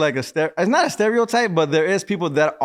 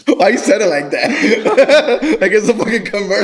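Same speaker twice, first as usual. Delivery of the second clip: high, loud laughing words; cracking up; 6 semitones higher, 5 dB louder than usual. Real, not acted. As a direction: talk through a laughing fit. Host cracks up at guest's line.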